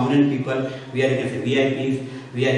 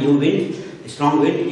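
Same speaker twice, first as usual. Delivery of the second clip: ordinary talk, nothing marked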